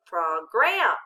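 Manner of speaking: "Program" mispronounced as prog-RAM, second syllable punched; accent on second syllable. Shown as a demonstration. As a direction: hit the end of "Program", short o in the first syllable. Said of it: "changing where we accent it".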